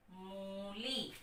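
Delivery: मूली said very slowly, syllables joined; teacher drawing out मूली to blend it; slow blended word. Shown as a demonstration. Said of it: "तो मैं".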